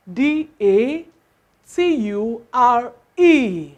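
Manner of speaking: letters read out one by one, slow; spelled out slowly. Shown as a demonstration. Teacher spells word endings to the class, slowly. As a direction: spell each ending deliberately. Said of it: "words that ends in".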